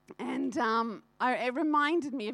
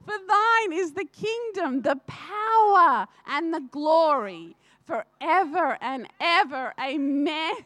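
higher, louder, slow prayer through real tears; tearful prayer; 4 semitones higher, 6 dB louder than usual. Real, not acted. Praying aloud to the congregation, tearful and slow. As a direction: pray it out, moved close to tears.

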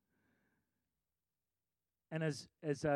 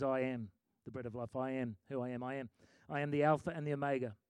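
solemn quiet quote; 5 semitones lower, 5 dB quieter than usual. Acted. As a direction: lower and softer, reverent. Jesus quoted, lower.

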